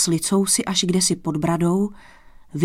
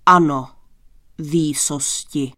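booming low first word, slowing after; low, thunderous confirmation; thunderous assent; 4 semitones lower, 3 dB louder than usual. A narrator's reading. Stout newcomer confirming to the king, thunderous. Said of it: "přisvědčil hromovitě muž".

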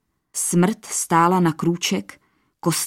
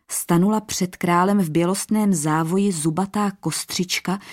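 plain reading voice

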